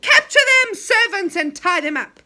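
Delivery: very high, loud orders; commanding shout. Acted. Enchanter ordering the imps to capture them, loud.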